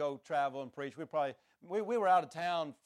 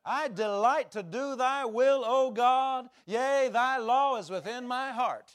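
slow, loud, higher call to proclaim; retold command to holler; 8 semitones higher, 6 dB louder, slower than usual. God telling the preacher to holler it out. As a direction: ring out the words slowly, lifting the voice. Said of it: "He said".

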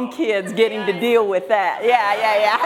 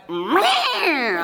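high mewling mock baby wail; imitating baby's angry cry; 6 semitones higher than usual. Grandma imitating the newborn grandson's angry squall. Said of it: "screaming mad".

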